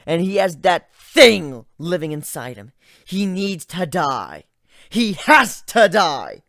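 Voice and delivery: loud, slow, deadly firm; fierce insistence. Acted. Griffin insisting to Gilda that Ivan must die, fierce. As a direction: hammer every word home.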